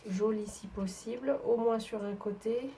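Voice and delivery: ordinary talk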